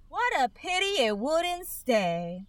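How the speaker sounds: soft, lightly sighing regret; a gentle sigh of regret. Acted. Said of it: "sighed the lorry".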